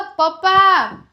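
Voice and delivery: slow, high, drawn-out પપ્પા; slow loud call. Shown as a demonstration. Mother modelling a slow loud પપ્પા for her toddler. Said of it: "મોટેથી બોલ".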